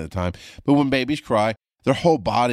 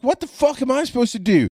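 much higher, exasperated yell; panicked retort; 4 dB louder than usual. Retold or imitated. Husband snaps back at frantic wife, high exasperated yell. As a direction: throw it back at her in a panic. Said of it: "And I tell her, I'm like".

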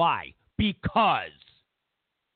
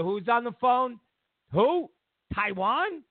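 ordinary delivery

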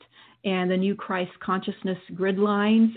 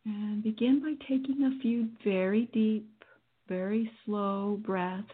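guided meditation. Acted. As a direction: guide them through calmly.

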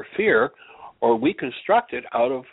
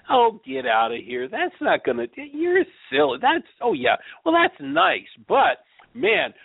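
much higher sneering voice, brushing the idea off; mocking scoffer; 13 semitones higher than usual. Preacher mocks the scoffing world in a high, sneering voice. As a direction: play the dismissive world laughing at the preacher. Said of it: "so the world goes".

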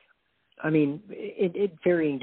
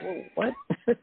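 mock disbelief. Told to grow thick skin, squeaky mock disbelief. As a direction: play stunned by the hate. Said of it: "It's like".

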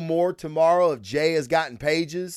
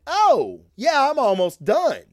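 high, loud and offhand, brushing it off; breezy casual reply; 9 semitones higher, 4 dB louder than usual. Retold or imitated. The artist brushing off the host's question, breezy and offhand. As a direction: toss it off like it's no big deal.

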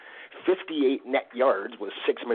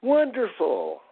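ordinary talk

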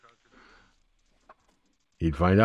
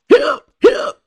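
high squeaky hiccups; hiccup sounds; 7 dB louder than usual. Retold or imitated. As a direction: yelp out each hiccup up high. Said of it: "I sit up in bed and go".

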